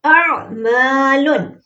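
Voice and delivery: अअमालुन with the मा held one alif long; slow recitation. Shown as a demonstration. Teacher models correct madda, slow recitation. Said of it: "अलीफ से पहले ज़बर है".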